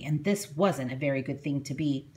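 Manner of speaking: ordinary delivery, nothing marked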